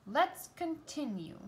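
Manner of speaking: lightly voiced grumpy crab; a touch of grumpy conductor. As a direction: scold the band with mild irritation. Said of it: "Sebastian said grumpily".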